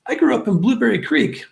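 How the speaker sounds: high-pitched, eager announcement; imitated excited reveal. Canadian legionnaire revealing hometown to fellow countryman, excited. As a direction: mimic someone thrilled to name their hometown.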